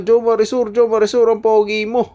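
high, loud fan voice calling out; mock fan shout. Vlogger voicing fans on the ride, high and loud. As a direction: shout it like an excited admirer.

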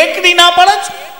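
high, slow, shocked question; can't believe he's refusing; outraged disbelief. Retold or imitated. Host pushing another sweet.

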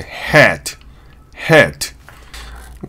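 ヘッド as English hat, vowel between 'ah' and 'eh'; American hat, wide 'æ'. Shown as a demonstration. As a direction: say ヘッド with the long cat vowel. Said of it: "あとへの中間なんですよね".

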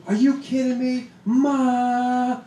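mock scream. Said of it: "She starts screaming".

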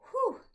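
sharp exhaled whew after running out of breath; whew of recovery. Real, not acted. Teacher finishing the breath test, winded whew. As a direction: let out a big puff of relief.

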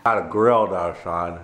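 talking with whitening strips in; with whitening tray. Real, not acted. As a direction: mouth full of whitening strips. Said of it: "trying to talk with whitening strips is kinda funny".

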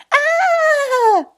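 high, loud scream of fear; frightened yell. Acted. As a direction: shriek in sudden fright. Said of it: "screamed the boys again".

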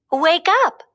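high bright call to a friend; young bunny calling. A narrator's reading. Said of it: "a bunny named Thumper called".

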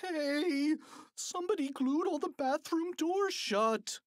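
high-pitched indignant holler; shouting. A narrator's reading. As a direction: cry out another prank from the crowd. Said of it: "with people shouting everything from".

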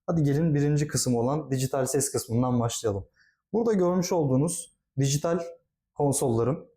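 ordinary talk, nothing marked

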